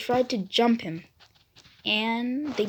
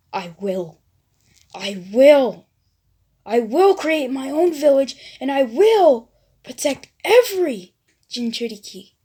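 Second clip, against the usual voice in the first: building vow; 3 semitones higher, 6 dB louder than usual. Acted. Naruto vows to Bakugo, rising into a shout. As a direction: swear it, growing with every I will.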